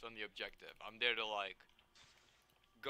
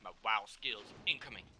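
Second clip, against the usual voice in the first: normal talking voice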